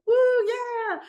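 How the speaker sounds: very high, loud gleeful whoop; thrilled whoop. Pretend. Soul leaping off the platform of light, whooping high and loud. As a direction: let out a joyful whoop as you leap.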